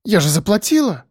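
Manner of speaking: high-pitched protesting patient; high, aggrieved objection; indignant woman. A narrator's reading. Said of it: "возмутилась женщина".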